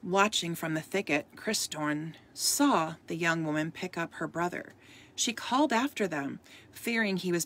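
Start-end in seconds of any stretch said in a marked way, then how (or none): none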